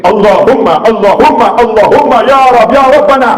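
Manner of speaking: rising cry up to ya Rabbana; fervent calling. Shown as a demonstration. Preacher showing the congregation how to call God, building to a high loud cry. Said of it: "bentuk manggil Allah Ta'ala".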